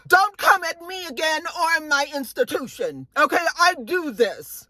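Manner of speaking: high-pitched, emphatic mock tirade; acted telling-off. [name] telling off the whole table, acted indignant.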